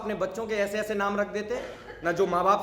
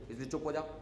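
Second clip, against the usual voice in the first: softer, lower hush to keep it private; 5 semitones lower, 12 dB quieter, faster than usual. Acted. Telling the crying drunk to be quiet, in a hush. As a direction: hush him so no one hears.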